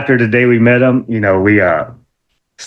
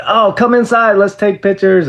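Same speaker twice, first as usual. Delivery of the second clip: higher, eager; eager invite; 10 semitones higher than usual. Retold or imitated. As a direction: bubble over with eagerness. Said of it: "I said".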